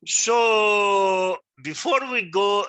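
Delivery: calling the class back to attention, loud and slow; a slow, loud summons to the students. Real, not acted. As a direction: raise the voice to regather the room.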